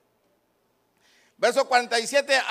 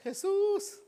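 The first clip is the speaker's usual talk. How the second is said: high, softened drawn-out call; reduced echo of a beggar's cry; soft high call; 9 semitones higher, 8 dB quieter than usual. Acted. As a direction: call the name high but small. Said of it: "¿No dijo Bartimeo".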